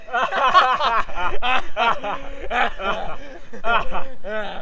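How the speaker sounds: a hearty laugh of scorn at the idea; scornful laugh. Acted. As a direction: laugh it off as absurd. Mocking the idea of equal rights.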